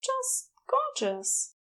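high, soft, slow and dreamy; admiring Aziraphale. A narrator's reading. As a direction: breathe it out in admiration.

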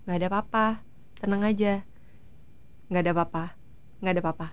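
low, calm reassurance; Adi. Retold or imitated. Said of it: "dia cuma bilang gitu". (menenangkan dengan lembut, nada rendah)

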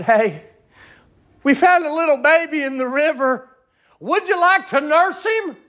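high, lightly voiced messenger; kind offer. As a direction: offer the baby gently. Princess's servant offers the baby.

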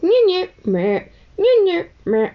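high, loud mock baby cry, meh meh; imitated newborn wail. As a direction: mimic a crying newborn on a video. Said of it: "I hope you know what I'm talking about".

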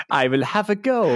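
playing the old woman in a hammed-up German accent; mock German accent. Retelling the film's old woman with a mild German accent. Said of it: "with a German accent".

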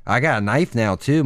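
usual speaking voice

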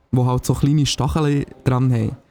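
ordinary talk, nothing marked

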